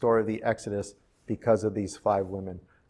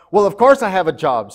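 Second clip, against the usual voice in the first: louder, higher, offended retort; imitating an indignant comeback; indignant snap; 6 semitones higher, 9 dB louder than usual. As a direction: snap back as an insulted mother. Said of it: "snapped Emily".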